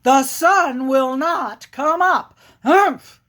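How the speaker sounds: emphatic bear chant with punched humphs; grumpy, insistent storybook bear; stubborn bear. Acted. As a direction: declare it firmly, ending in a loud huff. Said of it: "bear turns to him and says".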